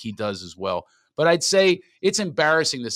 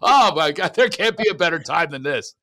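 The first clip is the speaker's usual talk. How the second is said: loud, raised mock enthusiasm; hammed-up phony thrill; sarcastic delight; 6 semitones higher, 8 dB louder than usual. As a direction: pretend it's the best time ever. Sarcastic fake delight continues.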